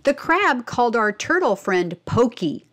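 usual speaking voice

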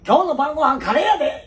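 high, loud mum calling out through laughter; high, loud, laughing cheery mum; cheerful mother calling. Retold or imitated. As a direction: call the kid home for dinner.

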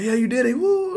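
very high, loud mock cheer; cheering crowd. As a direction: imitate onlookers whooping for a swimmer. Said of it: "everybody clapping".

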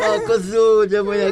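high, sing-song peddler voice. Acted. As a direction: hawk the goat like a trickster.

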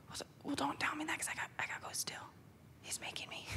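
quick, hushed protest under the breath; softly protesting to the branch president; whispered aside. Retold or imitated. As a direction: whisper it fast, half to yourself. Hushed aside back to him.

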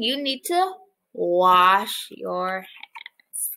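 ordinary talk

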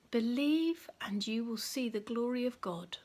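whispered. Acted. Jesus answers gently.

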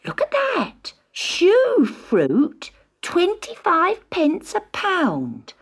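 high, loud cry of surprise; shocked market shopper; astonished shopper. A narrator's reading. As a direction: gasp it out to the crowd. Market crowd exclaiming.

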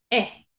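brief "E" as eh; short E sound. Shown as a demonstration. Teacher naming the short sound, brief "E". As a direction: cut "E" short, a quick eh. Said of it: "Sonido corto".

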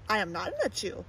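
ordinary delivery, nothing marked

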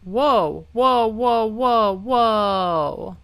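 loud rapid-fire cries of alarm; alarmed shouting. Real, not acted. Player watching it slip away, alarmed shouting. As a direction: yell it in a panicked string. Alarm as game goes wrong.

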